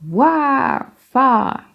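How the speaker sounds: "War" and "Far" with long open vowels, no r; "War", "Far" stretched, rhyming, r dropped; long British vowels. Shown as a demonstration. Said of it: "is much longer".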